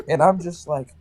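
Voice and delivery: ordinary delivery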